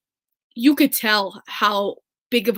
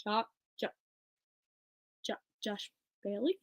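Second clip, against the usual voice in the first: stunned disbelief; 3 semitones lower, 12 dB quieter than usual. Retold or imitated. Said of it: "I was like".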